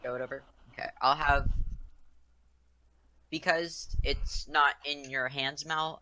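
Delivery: usual speaking voice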